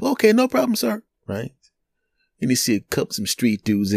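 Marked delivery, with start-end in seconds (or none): 0.0-1.0 very high-pitched voice; put-on high voice of a takeout counter worker; food counter worker. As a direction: squeak out the counter question. Counter worker answers customer in story.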